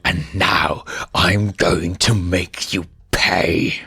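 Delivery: slow menace. Acted. Scar to the hyenas while choking them, slow and menacing. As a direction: drag out each word like a threat.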